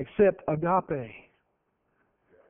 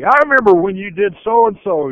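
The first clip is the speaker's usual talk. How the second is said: mock grudge; 3 semitones higher, 10 dB louder than usual. Mock grudge-holder quote.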